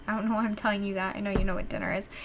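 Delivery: tired, soft voice. Real, not acted. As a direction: sound worn out and tired. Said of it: "I'm also tired".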